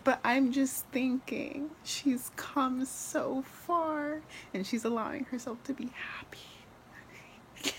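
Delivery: high, slow, voice thinning to almost nothing; choked up, fading into a tearful squeak; so moved you can barely get it out; close to tears. Real, not acted. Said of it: "I'm literally gonna cry".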